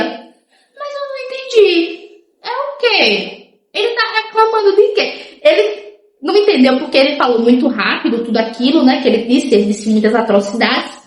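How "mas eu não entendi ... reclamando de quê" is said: high, slow, bewildered foreigner voice; confused gringo friend. Retold or imitated. Gringo friend on the beach, slowly and high, baffled. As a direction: play the lost foreign friend, drawn out and high. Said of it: "ele sentava assim na praia e fazia".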